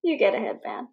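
high, laughing surfer bro; easygoing bro with a laugh; frat bro. Retold or imitated. Imitating bro Johnny.

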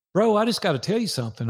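urgent blurt. Retold or imitated. Friend blurts out blunt news.

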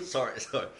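ordinary delivery

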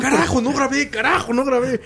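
high, exasperated cry; excited exclamation. Retold or imitated. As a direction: blurt it out in frustration. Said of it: "Siempre digo".

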